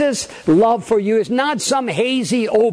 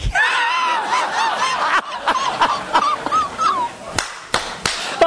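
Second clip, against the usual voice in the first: big laugh. Real, not acted. Turkey winked at him.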